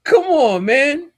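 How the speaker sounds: scoffing. Real, not acted. Scoffing after joke.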